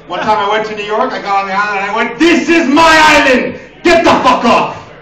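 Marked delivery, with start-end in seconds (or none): 2.2-4.9 loud, high, drawn-out shouting; territorial yelling. Acted. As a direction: bellow it slowly like you own the place. Acts out his yelling on the island.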